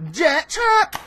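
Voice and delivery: usual speaking voice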